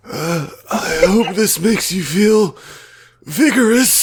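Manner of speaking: put-on high-pitched creepy, lecherous old man voice; Horace Kroon. Passing on a patron's message, creepy lecherous old man voice. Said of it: "my best Horace Kroon voice".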